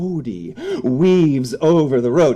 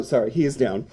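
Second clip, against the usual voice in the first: normal talking voice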